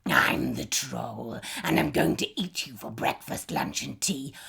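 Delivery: troll threatening to eat the goat, low and gloating. A narrator's reading.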